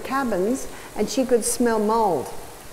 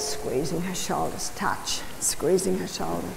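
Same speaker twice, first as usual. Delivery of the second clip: soothing hush; 4 semitones lower, 9 dB quieter than usual. Shown as a demonstration. Re-enacting soothing touch.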